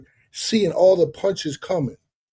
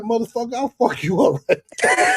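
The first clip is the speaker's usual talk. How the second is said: higher, raised-voice threat; furious threat; 7 semitones higher than usual. Retold or imitated. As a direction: snap back at the father, ready to fight. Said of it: "I'm going crazy".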